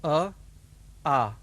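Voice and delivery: short अ then long आ, said apart; vowel demo. Shown as a demonstration. Teacher modelling अ and आ for learners. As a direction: model the two vowels slowly and clearly. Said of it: "उच्चारण पाठ एक".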